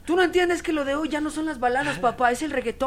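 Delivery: high, loud insistence; pleading daughter. Acted. Acting daughter pleading to father.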